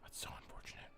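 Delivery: breathy whisper, barely audible; hushed, disappointed whisper. Real, not acted. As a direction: whisper it to yourself in dismay. Repeating a sad game result.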